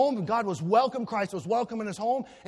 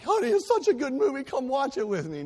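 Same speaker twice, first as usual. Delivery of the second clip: much higher, sing-song imitation of his wife; sweet wife; 7 semitones higher, 4 dB louder than usual. Wife inviting husband to her movie, sing-song. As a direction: coo the invitation. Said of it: "she's like".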